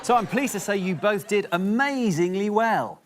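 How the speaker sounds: mock praise, dripping sarcasm; sarcastic. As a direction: say it like you mean the opposite. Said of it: "I was being sarcastic".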